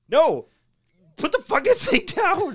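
high, mock-frantic yell with a laugh; exasperated yelling. Storyteller yelling at the man holding the bobcat, mock exasperation. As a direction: shout it in comic exasperation.

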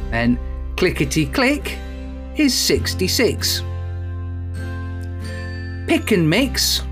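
sing-song bingo number calls; bingo caller. Acted. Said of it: "eyes down, here's the answer".

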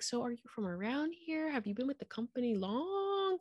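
high, soft imitation of prying small talk; mock polite probing. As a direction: imitate an overly polite colleague fishing for answers. Mimics probing colleagues, high and soft.

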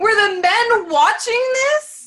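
high, loud, laughing disbelief; shocked squeal. Real, not acted. Shocked laughing reaction to story.

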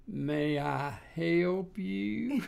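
very slow, stretched-out waitress voice; slow Georgia drawl. Retold or imitated. Retelling a Georgia waitress, mock slow drawl. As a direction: drag every word out like a Southern drawl. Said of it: "never heard anybody speak as slow as you did".

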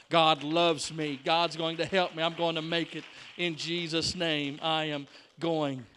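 loud, slow, measured preacher's affirmation; emphatic declaration. Acted. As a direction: hammer out each phrase with conviction. Declares faith with building force.

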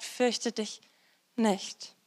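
leise, ruhig zugesprochen; sanfter Zuspruch. Acted. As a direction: beruhige ihn behutsam. Stimme Gottes spricht zu Jairus. (Gottes Stimme tröstet Jairus ganz leise)

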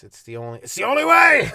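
hammed-up anguished cry; mock dramatic shout. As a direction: belt it like a melodramatic vow. Mock dramatic egg thrower.

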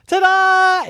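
high, loud drawn-out fanfare; triumphant ta-da. A narrator's reading. Little gymnast announces herself to the family, triumphant. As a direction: belt it out like a little show-off. Child showing off to mirror.

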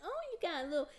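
put-on high, fast cooing baby talk; annoying baby talk. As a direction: coo it like talking to a toddler. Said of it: "my voice is so annoying".

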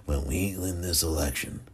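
Ronald Reagan. Acted. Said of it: "That's the most pathetic Ronald Reagan".

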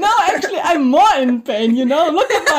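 high-pitched, breaking into laughter; laughing protest. Real, not acted. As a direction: complain through real giggles. Laughing together over hurting hand.

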